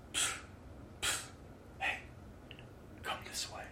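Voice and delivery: breathy psst then a hushed call; soft whisper. Acted. God whispering to the listener to come this way. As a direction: beckon in a secret whisper, barely audible. God calling softly to draw you.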